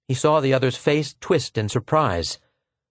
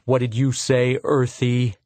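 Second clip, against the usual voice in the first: repeated menace; 2 semitones higher than usual. Acted. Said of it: "I said".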